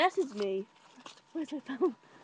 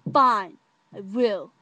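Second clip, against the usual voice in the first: loud, slow, drawn-out concession; emphatic giving in; 8 dB louder, much slower than usual. Retold or imitated. Agreeing to go out with him, emphatic. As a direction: stretch FINE out loudly.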